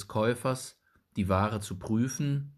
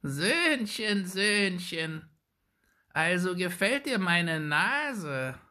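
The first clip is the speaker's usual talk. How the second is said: put-on high, slow, creaking old hag; creaky witch voice; 11 semitones higher, slower than usual. A narrator's reading. Wicked old woman taunting little Jakob, high creaky and slow. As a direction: taunt the boy sweetly like a wicked crone. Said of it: "mit knarrender Stimme".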